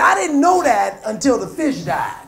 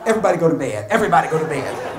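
stern parent; 7 semitones lower, faster than usual. Retold or imitated. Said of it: "parent ordering kids to bed".

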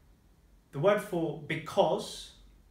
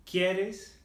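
"quieres" pitched up, higher at the end; 4 semitones higher than usual. Shown as a demonstration. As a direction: lift "quieres" into a question. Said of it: "Up more on the".

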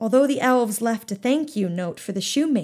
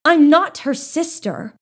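indignant outburst; 6 semitones higher, 7 dB louder than usual. A narrator's reading. Ella protests angrily.

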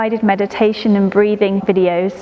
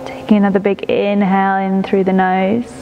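guided meditation. Acted. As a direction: ease the listener into stillness, breath by breath. Leads a prenatal body-scan meditation.

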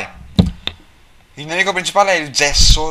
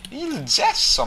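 ordinary talk

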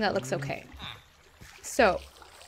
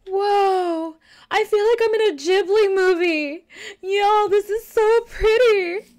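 delighted awe; 8 semitones higher, 4 dB louder, slower than usual. Real, not acted. Admires pretty world.

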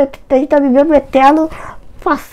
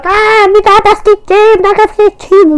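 dream shout; 8 semitones higher, 4 dB louder than usual. Retold or imitated. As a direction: cry out for coffee like waking from a nightmare.

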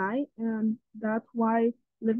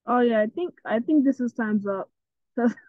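ordinary talk

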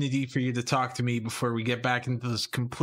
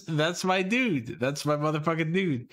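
normal talking voice

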